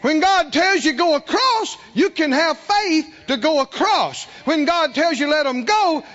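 high, punchy sermon delivery; forceful preaching. Real, not acted. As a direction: drive each line home to the congregation.

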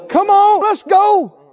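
high, loud eager rallying cry; rallying call. Retold or imitated. Preacher voices Caleb rallying the people with a high, loud cry. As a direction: fire up the crowd like Caleb.

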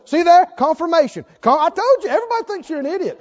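loud, high gloating devil voice; taunting devil. Pretend. Devil taunting the hurt listener, loud high gloating. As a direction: crow over him like the devil. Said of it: "the devil's going".

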